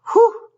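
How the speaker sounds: high, loud exhaled whew; sharp whew. Real, not acted. Feeling spirits arrive, a loud high whew. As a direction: let out a startled whoosh of breath.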